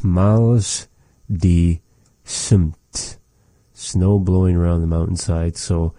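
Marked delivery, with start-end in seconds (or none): 0.0-3.2 Miles, die, Sumt, each slow and separate; word by word. Shown as a demonstration. Teacher repeating a recorded phrase for learners, "Miles die Sumt" word by word. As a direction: say "Miles die Sumt" with clear pauses between words.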